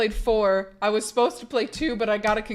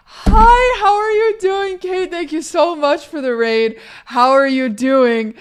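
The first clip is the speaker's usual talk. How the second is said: excited greeting; 6 semitones higher, 8 dB louder than usual. Real, not acted. Streamer greets a friend bringing a raid, excitedly. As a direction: shout hello in delight, then settle.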